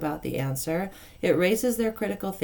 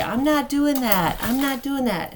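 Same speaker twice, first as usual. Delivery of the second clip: put-on defiant child; 8 semitones higher, 4 dB louder than usual. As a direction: play a child refusing stubbornly. Child refuses, imitated.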